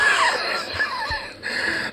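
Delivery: squeaky, breathless laughter; high wheezing laugh. Real, not acted. Cracks up at nothing there.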